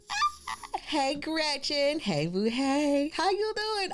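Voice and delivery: high, drawn-out excited greeting; gushing hello. Real, not acted. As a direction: stretch the hello out in a high squeal. Gushing hello to a regular viewer.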